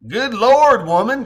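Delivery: loud, high country-twang exclamation. Acted. Joe teasing Courtney, loud country twang. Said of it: "Joe says with a country twang".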